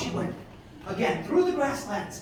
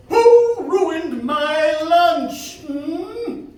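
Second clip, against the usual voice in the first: loud, very slow, drawn-out indignant eagle; grumpy eagle; 6 semitones higher, 10 dB louder, much slower than usual. A narrator's reading. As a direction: demand who spoiled his meal. Angry eagle lost his fish.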